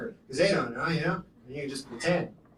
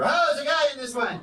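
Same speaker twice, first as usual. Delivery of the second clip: loud, high cry of discovery; suddenly raised, startled shout; 8 semitones higher, 4 dB louder than usual. Acted. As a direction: call out in mock alarm. Acts finding someone in wreck.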